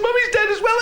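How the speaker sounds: put-on high child's hello; little girl voice. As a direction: answer as the small daughter. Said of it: "the little voice".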